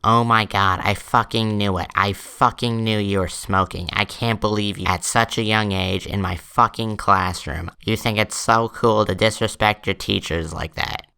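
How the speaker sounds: mock-outraged scolding; acted teacher's rant. Teacher catches George smoking in her classroom, mock outraged. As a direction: imitate a teacher tearing into a student.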